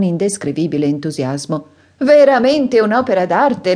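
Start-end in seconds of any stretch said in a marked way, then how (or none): 2.0-3.8 high, loud burst of enthusiasm; delighted exclaim. A narrator's reading. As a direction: cry out in delight at the candlestick. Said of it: "esclamò egli ridendo".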